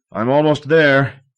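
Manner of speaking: shouted up, high and loud; shouting. A narrator's reading. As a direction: call out through the earth. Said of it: "he shouted".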